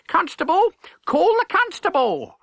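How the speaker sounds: calling for help. Acted. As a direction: summon the constable across the market. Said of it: "she cried".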